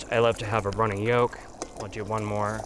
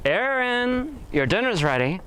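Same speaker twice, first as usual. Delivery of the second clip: calling to dinner; 12 semitones higher, slower than usual. Real, not acted. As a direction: call him in for dinner. Calls brother to dinner.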